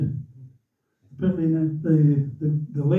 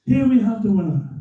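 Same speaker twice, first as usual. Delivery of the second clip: higher, ringing announcer voice; radio commentator; 4 semitones higher than usual. Retold or imitated. Commentator about to name the winner, imitated announcer. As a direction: imitate a commentator building to the result. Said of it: "He's the radio commentator".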